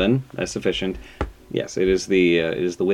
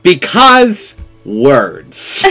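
slow, drawn-out and louder; hammed-up lofty reply; mock grand; 7 semitones higher, 11 dB louder, much slower than usual. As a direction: savour it like a pompous scholar. Playful pompous answer.

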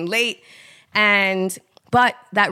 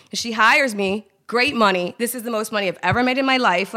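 normal talking voice

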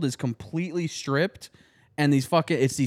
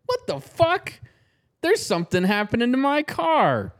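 lightly voiced high-pitched bewildered car owner; mildly hammed-up disbelief, pitched up; startled car owner; 7 semitones higher than usual. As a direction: imitate her alarm in a higher voice. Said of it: "And she's like".

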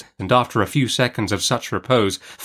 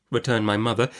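ordinary delivery

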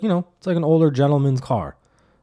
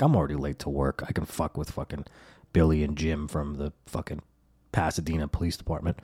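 low, muttered inner scheming; 8 semitones lower, 7 dB quieter than usual. Retold or imitated. Driver thinking about the cops, low scheming grumble. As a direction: grumble it to yourself, lower and softer.

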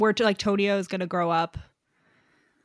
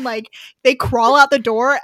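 normal talking voice

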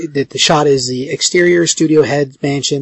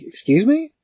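taken aback; 10 semitones higher, 3 dB quieter than usual. Retold or imitated. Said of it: "he's like".

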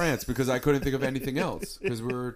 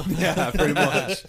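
laughing; 7 semitones higher, 8 dB louder, slower than usual. Real, not acted. Laughing at the memory.

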